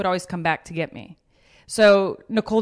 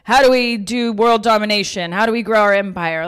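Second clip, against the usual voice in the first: louder, pumped-up rallying cry; excited ambition; 2 semitones higher, 7 dB louder than usual. Retold or imitated. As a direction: hype each other up about empire. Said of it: "we're like".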